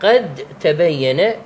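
slow, merged "قتّبين"; dal merged into ta. Shown as a demonstration. Teacher showing idgham of dal into ta, very slow. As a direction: say "قد تبين" very slowly with the dal of "قد" dropped into a doubled t, "قتّبين". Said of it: "تقرأها هكذا".

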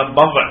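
«بضع» with a short a after the ب: baḍʿ; فتحة على الباء. Shown as a demonstration. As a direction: say «بضع» as baḍʿ, a vowel on the first letter. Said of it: "أما بفتحها". (نطق «بضع» بفتح الباء)

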